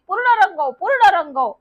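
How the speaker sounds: put-on girl hollering instructions in wild glee; excited calling out. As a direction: shout it while jumping on the pump. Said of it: "भराभरा उड्या मारत ... म्हणत होती".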